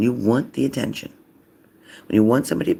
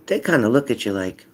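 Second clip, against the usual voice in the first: normal talking voice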